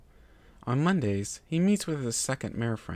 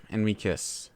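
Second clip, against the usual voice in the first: ordinary delivery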